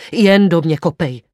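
loud, pleading cry; cried out. A narrator's reading. Severin begging Wanda to kick him, loud cry. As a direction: throw the words at her, begging. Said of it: "vykřikl jsem".

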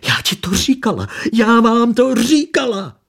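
high, loud hysterical screech; hysterical shrieking. A narrator's reading. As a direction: shriek it, losing control. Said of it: "Marcela začala hystericky ječet".

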